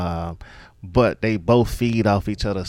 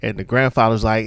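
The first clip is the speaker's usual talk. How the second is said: ordinary talk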